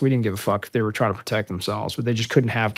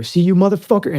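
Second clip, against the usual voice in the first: pitched up, louder gotcha; retold angry accusation; 8 semitones higher, 5 dB louder than usual. Marine pointing at what he found, retold heated accusation.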